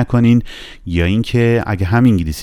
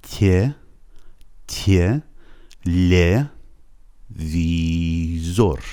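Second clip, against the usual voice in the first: slow, separated syllables tye, lye, vii-zor; syllable-by-syllable pronunciation; 2 semitones lower, much slower than usual. Shown as a demonstration. Teacher showing how it is pronounced, slow syllables. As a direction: sound out the word piece by piece, stretching "ویزور".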